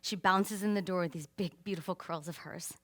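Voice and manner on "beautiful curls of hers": ordinary talk, nothing marked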